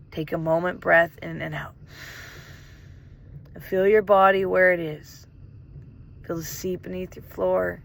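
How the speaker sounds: measured meditation guide with long pauses; guided meditation. Real, not acted. Guide asks listeners to greet a hidden part, guided meditation. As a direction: guide them gently inward, pausing to let it land.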